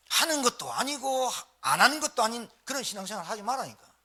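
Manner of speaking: normal talking voice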